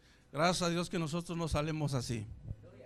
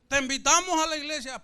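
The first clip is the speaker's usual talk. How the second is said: raised, loud imitated invitation; inviting evangelist; 6 semitones higher, 6 dB louder than usual. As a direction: call out an invitation like a preacher.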